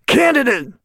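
high, outraged officer's bark; imitated base commander. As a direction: play an incredulous drill-style officer. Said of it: "looks at me and goes".

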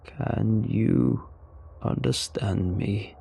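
slow, gravelly alien voice; halting, slow alien speech; low gravelly alien. Acted. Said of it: "in a low, gravelly voice".

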